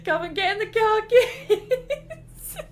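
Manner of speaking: high laughing put-on dad voice; hammed-up dad fetching kids; mock dad. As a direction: call the kids through giggles.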